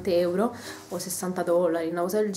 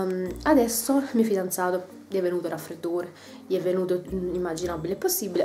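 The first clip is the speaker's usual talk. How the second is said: ordinary talk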